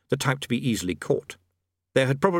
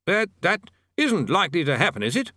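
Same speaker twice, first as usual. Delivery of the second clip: higher, hesitant, anxious; 7 semitones higher than usual. A narrator's reading. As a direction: ask it with worry. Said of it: "said the bursar anxiously".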